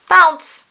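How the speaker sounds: loud high bounce cry; spring it out like a ball; bounce sound. A narrator's reading. Narrator voicing the trip, loud high bounce.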